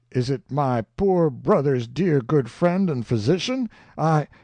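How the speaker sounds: a hint of a bad English accent, eager and fawning; fake English accent. A narrator's reading. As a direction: put on a poor English accent to greet him. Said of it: "the worst imitation I ever heard".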